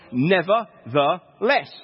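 "nevertheless" broken slowly into separate syllables; drawn-out emphasis. Shown as a demonstration. Preacher showing the word the verse hinges on, "nevertheless" slow and emphatic.

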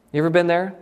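usual speaking voice

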